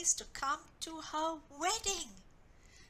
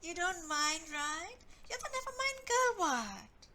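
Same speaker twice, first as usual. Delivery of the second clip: taunting; 5 semitones higher, 5 dB louder than usual. Acted. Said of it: "taunted her".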